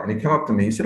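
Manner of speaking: ordinary talk, nothing marked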